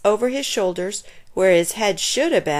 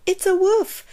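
high, urgent cry of alarm; startled high-pitched yell; 12 semitones higher, 4 dB louder than usual. A narrator's reading. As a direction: yell out a sudden high warning. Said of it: "and he yelled".

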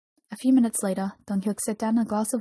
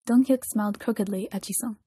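ordinary delivery

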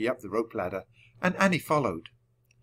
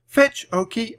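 high, loud call to a dog; shouted command; 11 semitones higher, 7 dB louder, slower than usual. A narrator's reading. Annie throws a ball for her puppy, shouting. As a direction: shout it brightly across a yard. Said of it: "Annie shouted".

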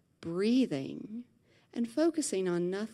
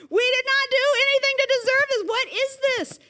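high, loud anguished wail to God; lamenting cry; 13 semitones higher, 8 dB louder than usual. Shown as a demonstration. Preacher acting out a psalm of lament to God, high anguished cry. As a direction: cry out your why to heaven. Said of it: "That's lament".

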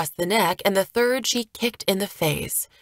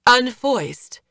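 high, loud commanding shout; girl's sharp battle command; 5 semitones higher, 5 dB louder than usual. A narrator's reading. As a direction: call it out as an order. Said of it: "a tone of command".

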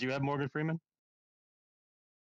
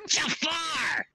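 shouting a name. Pretend. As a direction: yell the name out.

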